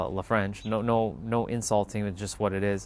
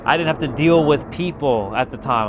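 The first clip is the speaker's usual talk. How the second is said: ordinary delivery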